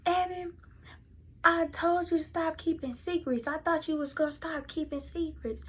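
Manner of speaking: fussing mother. Retold or imitated. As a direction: act out a mother fussing at her child. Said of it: "she just fussing".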